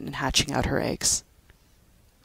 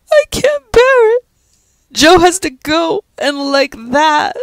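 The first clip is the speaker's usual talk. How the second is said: much higher, louder, breaking wail; weeping wildly; 12 semitones higher, 11 dB louder than usual. A narrator's reading. Pat sobbing harder in Judy's arms. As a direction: let the sob break through. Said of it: "Pat was weeping wildly".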